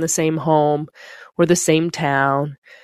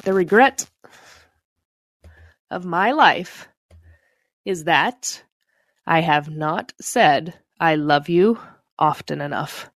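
each phrase set apart by long pauses; slow, measured quote reading. Shown as a demonstration. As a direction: read it slowly, letting every phrase land.

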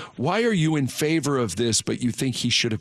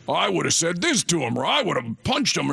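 lightly voiced tough guy, pitched up; mock tough guy; 5 semitones higher than usual. Host imitating dudes' reactions to the bit, mild tough-guy bluster. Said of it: "all that tough guy stuff".

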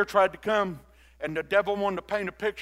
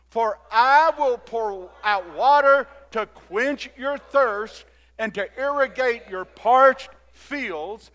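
slow, raised, declamatory reading; preaching scripture aloud; 4 semitones higher, 8 dB louder than usual. Real, not acted. Preacher reads verse out loud with congregation, slow and ringing. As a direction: proclaim the verse phrase by phrase for all to follow.

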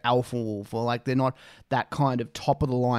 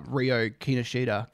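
ordinary delivery

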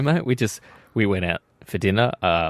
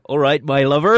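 louder mock West Country greeting; Dorset accent; 3 semitones higher, 5 dB louder than usual. Host offering listeners the next accent, mimicked Dorset. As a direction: imitate a Dorset farmer lightly. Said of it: "With a Dorset".

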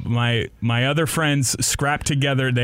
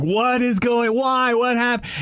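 high, alarmed questions; blinded and scared, asking wildly; panicked confusion; 10 semitones higher than usual. Retold or imitated. Pepper-sprayed and dragged out, high panicked questions. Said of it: "and I'm like".

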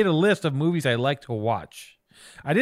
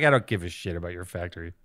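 low, fast, dismissive mutter; dismissive quote; 8 semitones lower, 4 dB quieter, faster than usual. Retold or imitated. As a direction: brush it off quickly. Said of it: "I was like".

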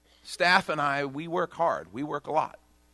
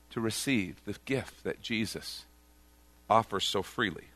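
ordinary talk

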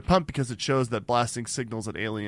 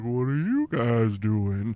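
curious whale voice; slower than usual. Retold or imitated. Whale voiced casually swimming over.

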